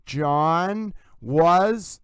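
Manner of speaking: "John" held long, then a loud long "was"; hanging N. Shown as a demonstration. Host copying the voicemail, hanging N and weighty WAS. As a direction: stretch JOHN on the N, push WAS. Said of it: "the N hangs".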